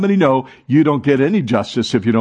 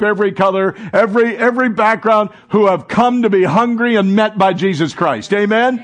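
raised, urgent preaching to the congregation; fervent preaching; 6 semitones higher than usual. Real, not acted. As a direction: lift the room with hope. Pastor rising into preaching.

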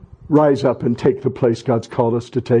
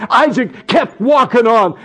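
full-force preaching; 9 semitones higher, slower than usual. Acted.

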